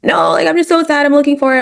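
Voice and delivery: put-on a high-pitched upset child whining. Parent imitates a dysregulated child crying over a lost toy, high whine. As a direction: pitch way up like a small kid who's too upset to listen. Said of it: "dysregulated that they're like".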